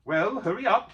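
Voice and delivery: a hint of impatient hobbit; lightly voiced hurry; lightly voiced Bilbo. A narrator's reading. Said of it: "said Bilbo".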